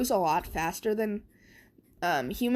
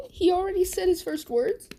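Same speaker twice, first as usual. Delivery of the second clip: high, delighted surprised mother; high amazed mother's voice; 10 semitones higher than usual. Retold or imitated. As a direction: gasp it out in happy disbelief. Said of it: "And Inko's like".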